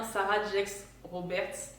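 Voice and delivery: Sarah Jakes Roberts in a slow, clumsy English accent, French speaker. Shown as a demonstration. Vlogger to viewers, slowly tries an English accent. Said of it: "Mon accent est tellement nul".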